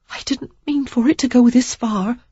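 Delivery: high, rushed pleading; babbling woman. Acted. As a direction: she tumbles over her words. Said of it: "she babbled".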